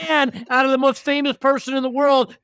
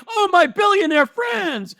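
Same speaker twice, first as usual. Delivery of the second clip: higher, slow mock fawning; mocking fan; 6 semitones higher than usual. Teasing his cohost as a billionaire-worshipper, mock fawning voice. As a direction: ham up a starstruck worshipper. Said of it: "You're like".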